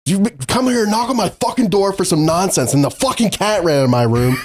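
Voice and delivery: high-pitched ranting, mock fury; retold anger. Re-enacting a rant about the cat in the room, mock fury. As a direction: rant in a high voice, cracking up on the curse. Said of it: "That's so mad".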